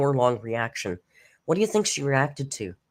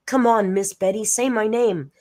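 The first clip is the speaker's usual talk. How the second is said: put-on higher-pitched childlike plea; pleading; 5 semitones higher, 3 dB louder than usual. Viewer begging Miss Betty to say their name, pleading. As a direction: beg hopefully, pitched up.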